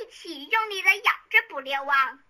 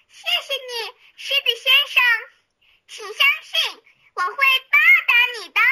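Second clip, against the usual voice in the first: high, louder thankful mouse voice; grateful mouse; 8 semitones higher, 4 dB louder than usual. A narrator's reading. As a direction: promise the lion with gratitude. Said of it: "老鼠非常感谢地说".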